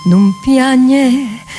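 ordinary delivery

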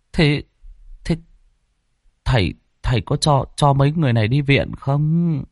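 halting, hesitant questions; stammering. Acted. Villager timidly questioning the exorcist, stammering. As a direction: stumble and restart, with long uncertain gaps. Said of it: "Ông Kẹt ấp úng".

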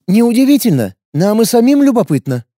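wary fighter, blunt and guarded; young warrior woman. A narrator's reading.

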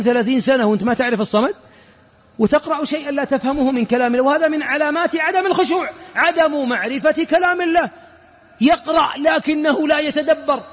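ordinary talk

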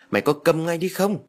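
high-pitched mild narrated yell; loud scolding. A narrator's reading. Grandmother silencing the crying child, mild angry yell. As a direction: scold crossly without real volume. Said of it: "bà quát to".

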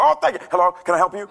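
high, loud, hammed-up gratitude; mock relief. Man answering the call he prayed for, mock relief. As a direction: leap on the call with exaggerated delight.

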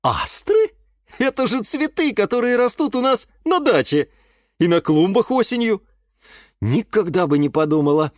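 high, lilting amazement; surprised. A narrator's reading. Mother learning about the flowers at her dacha, surprised. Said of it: "удивилась мама Юры".

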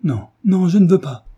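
desperate mother's cry; heartrending cry. Acted. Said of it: "s'écria d'une voix déchirante".

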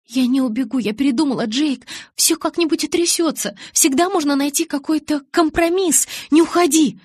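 high, loud frantic begging; desperate pleading. Acted. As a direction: beg him not to go. Bella begs Jacob to stay.

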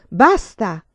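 crowd shout. Acted. La folla ripeté il grido.

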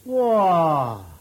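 loud, drawn-out cry of dismay; astonished wow. Acted. Servant sees the wild hill, astonished. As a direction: let out a long, loud wow.

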